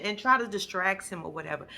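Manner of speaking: normal talking voice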